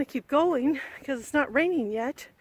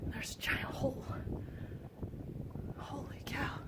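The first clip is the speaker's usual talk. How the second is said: whispering; 11 dB quieter than usual. Real, not acted. Hushed awe at cave hole.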